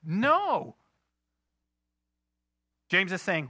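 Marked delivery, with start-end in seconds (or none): 0.0-0.8 high-pitched demonstrated outcry; acted yell against an injustice; crying out, yelling. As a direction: cry it out in protest. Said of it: "You ever cried out and yelled".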